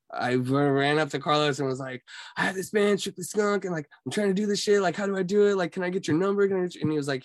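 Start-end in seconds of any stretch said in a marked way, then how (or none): 2.4-6.8 higher-pitched, rushed pleading; retold excited pitch, voice raised high; eager young pitch. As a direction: imitate a kid nervously asking for a chance. Acting out eager younger self.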